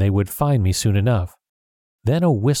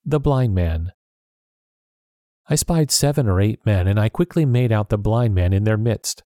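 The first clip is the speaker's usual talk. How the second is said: plain reading voice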